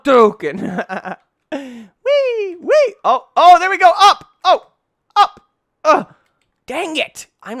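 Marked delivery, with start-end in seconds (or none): none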